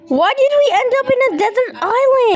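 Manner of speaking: high, fast whiny protest; indignant complaint. Pretend. Kid protests landing on a desert island, indignant complaint. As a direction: complain in a quick, high-pitched voice. Child complains about where they landed.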